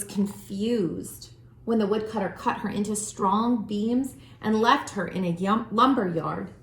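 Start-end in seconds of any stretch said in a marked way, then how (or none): none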